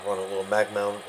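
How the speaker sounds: usual speaking voice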